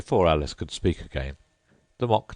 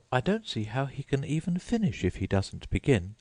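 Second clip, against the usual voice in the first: put-on higher girlish voice for Alice; Alice's voice; 9 semitones higher than usual. A narrator's reading. Narrator giving Alice a higher voice. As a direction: lift the voice for young Alice.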